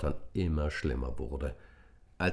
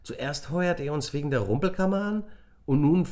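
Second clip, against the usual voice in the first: higher, louder indignant scolding; girl scolding the boys for a silly trick; annoyed girl; 10 semitones higher, 5 dB louder than usual. A narrator's reading. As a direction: tell them off, fed up. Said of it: "stemmte die Hände in die Hüften".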